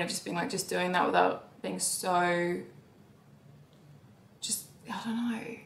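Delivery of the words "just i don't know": whisper. Real, not acted. Quiet hesitation.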